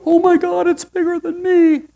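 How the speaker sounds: high, loud overwhelmed cry; mock panic. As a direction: freak out at the size of it. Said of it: "and you go".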